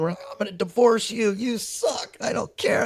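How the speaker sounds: retold marital spat, pitched high; mock fight yelling. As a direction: throw the insults like a bickering wife. Parents' blow-up fight quoted.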